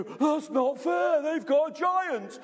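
high, loud drawn-out playground protest; protesting schoolboys. Retold or imitated. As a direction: wail it like an indignant schoolkid. Rival schoolboys protesting at the giant.

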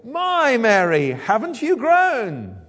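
high, loud, slow mock cooing; fussing relative. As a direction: gush over a grown-up youngster. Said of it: "She doesn't say".

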